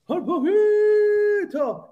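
very high, slow, stretched mock cry; mocking catchphrase. Streamer mocks the rival fans with a high, stretched cry. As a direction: stretch it high like a cheeky chant.

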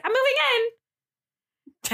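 high, very slow sing-song announcement; lightly voiced roommate, high and slow; drawn-out announcement. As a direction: stretch it out like a cheeky reveal. Roommate's announcement, acted quote.

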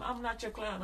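normal talking voice

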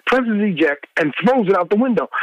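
ordinary talk, nothing marked

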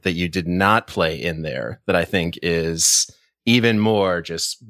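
ordinary talk

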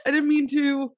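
high, hurried pleading apology; mock-apologetic sister. S1 voicing the sister apologising for the orca jab, high and pleading.

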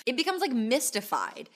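usual speaking voice

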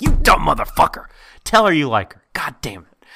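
loud frustrated yell at a TV character; yelling at the screen. Retold or imitated. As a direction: shout it at the screen like he can hear you. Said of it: "scream at these fictitious characters".

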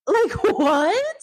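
high laughing what; laughing disbelief. Real, not acted.

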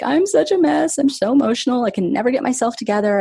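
high, whiny, self-pitying wail; mock self-pity. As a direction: act out falling apart in front of her mentor. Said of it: "I was telling her, like".

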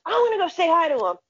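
high, fast imitation of an excited friend; mock overexcited rush; starstruck insistence. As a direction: burst out like an overeager fan. Friend slams table, wants to greet.

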